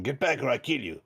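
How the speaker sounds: line acted with a hint of a Mexican accent; lightly put-on Mexican-sounding accent; slightly Mexican accent. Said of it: "Mexican there, actually".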